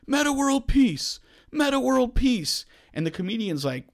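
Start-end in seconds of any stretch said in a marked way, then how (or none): 0.1-2.7 rising chant, pitched way up; a heckler hollering from the bar; mock heckler yell. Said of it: "He starts yelling out".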